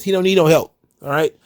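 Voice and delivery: normal talking voice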